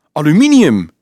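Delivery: high, loud exclamation of recognition; astonished. A narrator's reading. Dolf recognises the metal box, astonished. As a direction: blurt it out loudly in amazement.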